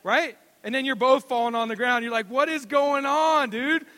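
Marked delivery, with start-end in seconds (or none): 2.3-3.8 father after a fall, raised incredulous voice; exasperated dad. Retold or imitated. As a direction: scold the kid in mock disbelief.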